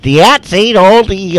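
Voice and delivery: loud Boston accent, dropped Rs. Shown as a demonstration. Host answering a chat viewer from Massachusetts, in a loud Boston accent. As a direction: drop the R like a Massachusetts local. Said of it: "a funny phrase I can use".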